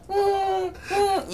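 high, stifled "mm" snickers; imitated snickering. Imitating housemates laughing during the movie, stifled high snickering. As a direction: mimic people holding in laughter. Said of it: "you know, like, snickering".

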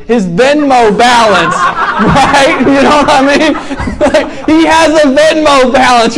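laughing through the joke. Real, not acted. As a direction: chuckle the words out.

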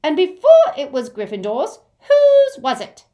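very high, loud goblin challenge; irate goblin, building in pitch and volume; demanding goblin. Acted. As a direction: demand an answer, rising to a piercing peak. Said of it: "demanded the goblin".

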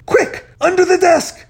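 high, loud frantic order; desperate shout. A narrator's reading. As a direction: shout as if about to be caught. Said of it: "when Z shouted".

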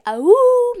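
short, high, loud "awoo"; werewolf howl. Retold or imitated. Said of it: "Classic werewolf".

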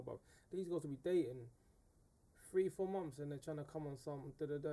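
ordinary talk

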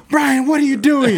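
high, loud scolding teacher voice. Retold or imitated. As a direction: bark it like a fed-up teacher. Teacher scolds him in class.

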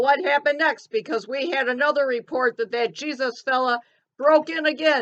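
ordinary talk

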